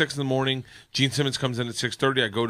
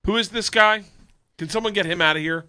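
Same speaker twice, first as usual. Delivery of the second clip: dismissive rock star; 7 semitones higher than usual. Retold or imitated. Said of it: "And he just goes".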